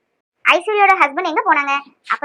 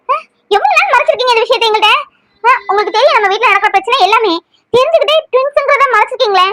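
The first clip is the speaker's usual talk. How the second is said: angry accusation; 5 semitones higher than usual. Acted. Woman angrily accusing her sister-in-law of hiding twins. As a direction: hurl the blame at her.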